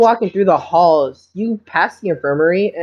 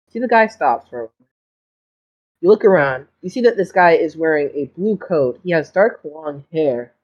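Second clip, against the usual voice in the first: normal talking voice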